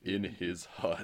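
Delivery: slow, drawn-out mock narration; drawn-out film line. Co-host parodying the film's long pauses, slow mock solemnity. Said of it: "don't quite finish their sentence".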